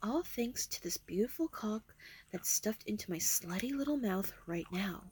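soft breathy whisper. Acted. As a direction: whisper it close and teasing. Seductive whisper.

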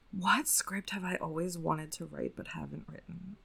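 soft whisper to herself. Real, not acted. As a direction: whisper it, reading aloud. Reading question to herself.

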